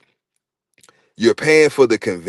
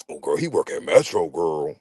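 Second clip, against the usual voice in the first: low, soft hammed-up gossip to a friend; whisper-brag about her man's job; gossiping girlfriend; 9 semitones lower, 6 dB quieter than usual.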